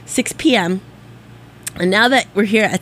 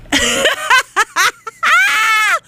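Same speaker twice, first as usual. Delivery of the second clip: loud laugh; 4 dB louder than usual. Real, not acted. Bursts out laughing on air.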